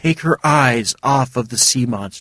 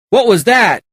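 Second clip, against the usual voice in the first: high alarmed call; yelling; 11 semitones higher than usual. A narrator's reading. Sigurd fallen on deck calling out, alarmed yell. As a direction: shout it after the noise. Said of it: "Sigurd yelled".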